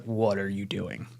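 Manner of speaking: soft, slow mock scold; a touch of a hushed scolding wife; wife's quiet scold. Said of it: "And Beck's like".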